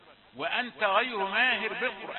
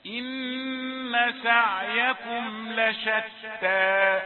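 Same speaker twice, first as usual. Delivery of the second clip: slow, drawn-out chanted recitation; Quran recitation; 3 semitones higher, 7 dB louder, much slower than usual. Shown as a demonstration. Teacher reciting verses to his students in slow tajweed chant. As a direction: intone the verse slowly and loudly.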